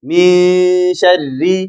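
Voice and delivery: Min sharri drawn out very slowly; very slow recitation demo. Shown as a demonstration. Teacher drawing out Min sharri slowly for students. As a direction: stretch Min sharri for learners. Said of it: "Raa kasraari".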